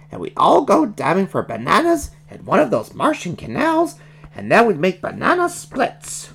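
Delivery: high, excitable cartoon voice; eager, swooping high-pitched character; inspired baby Gonzo. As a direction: spin a wild plan in a character voice.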